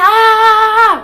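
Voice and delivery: high drawn-out "aah" yell; imitated long, high holler. Student re-enacting herself yelling, high long holler. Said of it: "I'm sitting there and I'm yelling".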